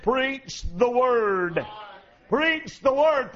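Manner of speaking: very slow, every word weighted; slow emphatic charge. Real, not acted. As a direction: lay Preach the Word down word by word. Repeating Paul's charge.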